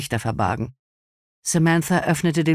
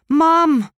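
very high, loud cry of Mom; shouting; 14 semitones higher, 6 dB louder than usual. A narrator's reading. Charlotte yelling for her mother again, a high piercing shout. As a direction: holler Mom across the house. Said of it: "schrie Charlotte".

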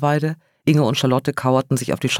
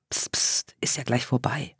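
whispered, much softer comfort; soothing whisper; 7 dB quieter than usual. A narrator's reading. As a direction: hush her gently, it's nearly over. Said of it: "Tröstend drückte Inge ihren Arm". (tröstendes Flüstern)

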